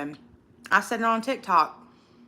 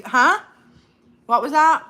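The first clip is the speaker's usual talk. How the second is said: loud, higher, challenging "Huh?"; mock confrontation; 6 semitones higher, 6 dB louder than usual. Imagining confronting the girls who mocked her, loud challenging demand. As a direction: get in their face, loud and daring.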